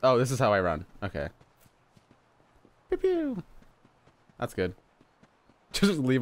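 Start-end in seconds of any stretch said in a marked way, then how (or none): 2.9-3.4 very high little mock laser shots; playful pew-pew. Player making noises while running around, playful. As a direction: squeak out toy gun noises.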